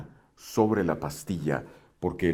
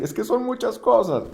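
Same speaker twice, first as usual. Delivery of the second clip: a whiny much higher complaint; much higher, louder mock whine; mock overwhelmed student; 7 dB louder than usual. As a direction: imitate a flustered learner. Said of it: "porque vas a decir".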